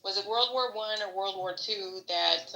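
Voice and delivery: ordinary delivery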